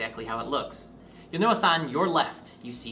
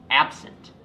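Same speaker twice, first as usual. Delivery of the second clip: "absent" with a stressed opening A; emphasis on A; 8 dB louder than usual. Shown as a demonstration. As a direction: lean on the A that starts "absent". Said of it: "emphasis on the A in absent".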